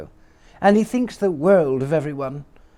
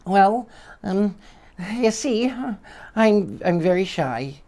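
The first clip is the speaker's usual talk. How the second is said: high, hesitant timid voice; shy squeaky voice; 6 semitones higher than usual. Acted. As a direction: squeak it out shyly. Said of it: "shy, squeaky voice".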